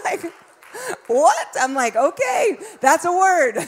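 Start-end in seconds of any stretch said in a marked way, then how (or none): 1.1-1.5 loud, high, taken-aback reaction; startled. Acted. Mother reacting to daughter's verse, startled outburst. 1.9-3.5 high, taken-aback exclamation; amazed. Acted. Mother answering daughter's startling verse, amazed exclamation.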